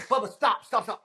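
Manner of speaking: high, loud retold protest; urgent plea. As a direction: beg him to stop, high and urgent. Retold panicked plea to Bubba.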